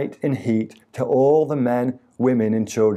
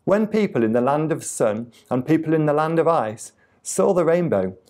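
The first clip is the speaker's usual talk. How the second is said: usual speaking voice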